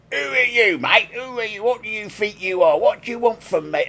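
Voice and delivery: high, brash Cockney voice for the rude bloke; Cockney accent. Retold or imitated. Tim retells the rude bloke's comeback in a Cockney accent. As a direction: snap back like a cheeky Cockney lad. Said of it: "changing his accent to".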